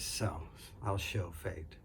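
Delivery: low, slow, hushed vow; low defiance. Acted. Daughter defying fate after throwing the baby, low and slow. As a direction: mutter it darkly, a quiet challenge to fate.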